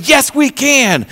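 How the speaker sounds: slow, high, firm reassurance; preacher voicing Joshua's stubborn faith; steady encouragement. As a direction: insist on it slowly. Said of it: "kept on saying".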